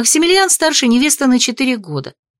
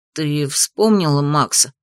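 put-on cautious boy; 6 semitones lower, slower than usual. A narrator's reading. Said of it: "осторожно поинтересовался мальчик".

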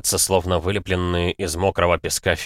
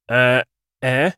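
dumbfounded half-word; squeezed out in shock; stunned stammer; 5 semitones higher, 5 dB louder than usual. A narrator's reading. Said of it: "только и смог выдавить". (ошеломлённое бормотание)

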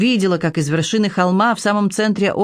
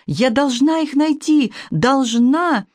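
frantic; 7 semitones higher, slower than usual. A narrator's reading. Girl frantic, running down.

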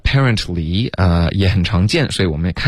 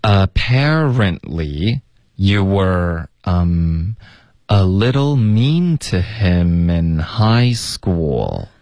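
very slow, drawn-out pronunciation model; slow model reading; much slower than usual. Shown as a demonstration. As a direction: stretch each phrase out for a learner to copy. Teacher models the line for repetition.

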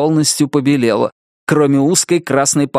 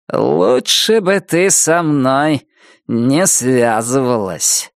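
slow controlled fury; 4 semitones higher, slower than usual. Acted. Wilma's final threat to Nettie, slow controlled fury. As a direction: spell out each word with cold rage. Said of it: "Чётко и медленно выговаривая слова тихим, но раскалённым от ярости голосом".

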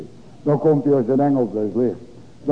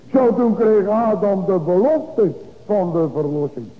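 raised, high, fervent pulpit voice; full-force preaching; 7 semitones higher than usual. Acted. Preacher lifting his voice to the congregation, fervent.

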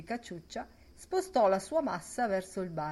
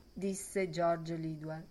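ordinary delivery

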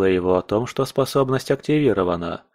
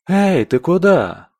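calling out; 8 semitones higher, 5 dB louder, slower than usual. Acted. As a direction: call out loud and high after him. Elf calls after retreating Oleg.

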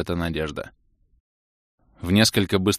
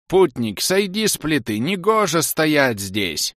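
high, slow cracked puppet voice; cracked doll voice; 7 semitones higher, slower than usual. A narrator's reading. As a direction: drone it like a broken talking toy. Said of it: "выговорил треснутый голос куклы".

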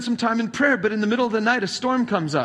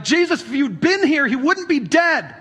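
higher, louder, pleading; grieving reproach; 7 semitones higher, 4 dB louder than usual. Retold or imitated. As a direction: throw the grief at him. Grieving sisters confronting late Jesus.

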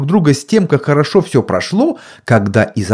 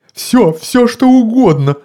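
frightened mumble; 12 semitones higher, 4 dB louder than usual. A narrator's reading. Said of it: "забормотал мистер Уилкс".

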